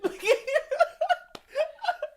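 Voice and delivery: high peals of laughter; laughing. Real, not acted. Game master amused by Smoke's stubbornness, laughing. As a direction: laugh helplessly, high and long.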